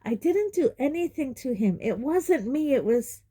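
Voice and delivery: put-on insistent boy; boy defending himself to uncle; Harry protesting. A narrator's reading. Voices Harry.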